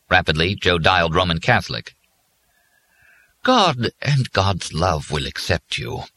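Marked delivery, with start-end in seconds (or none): 3.4-6.1 slow, distant, gentle comfort; faraway and gentle. A narrator's reading. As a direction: soothe him from far off. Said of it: "in a faraway, gentle voice".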